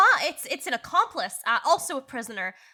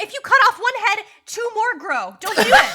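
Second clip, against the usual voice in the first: mock warning; 8 semitones higher, 8 dB louder than usual. Player bluffing imps.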